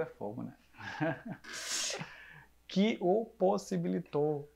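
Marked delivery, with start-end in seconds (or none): 2.7-4.5 each syllable slowly and carefully; careful slow speech for a lisp; slow and deliberate. Shown as a demonstration. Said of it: "Eu tenho que falar pausadamente".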